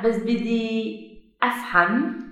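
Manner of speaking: normal talking voice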